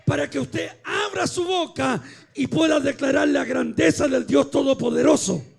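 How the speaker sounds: loud, driving Pentecostal exhortation; rally the congregation with a loud, forceful call; full-force preaching. Real, not acted. (predicación a plena voz)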